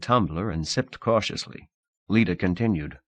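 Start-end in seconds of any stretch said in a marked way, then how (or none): none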